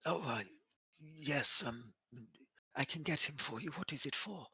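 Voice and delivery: put-on soft, high, frail old servant voice; timid elderly butler, hushed.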